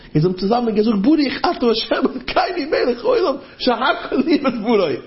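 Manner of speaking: high, urgent pleading voice; frantic soldier. Retold or imitated. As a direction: plead to pray, pitch held way up. Voices soldier urgently seeking a prayer.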